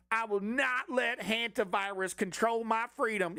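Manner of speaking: mock slogan voice. Mocking slogan again.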